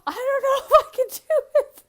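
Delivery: very high, loud mock-crying squeal; hammed-up tearful protest; panicked mock wail. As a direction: wail it fast in comic panic.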